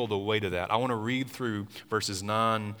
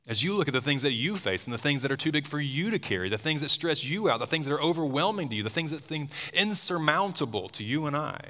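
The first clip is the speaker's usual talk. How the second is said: preaching with rising intensity; 6 semitones higher than usual. Acted. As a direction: build the sermon to a peak. Building sermon climax.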